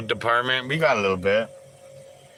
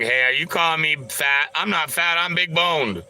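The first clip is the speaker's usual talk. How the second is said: mock defensive; 7 semitones higher than usual.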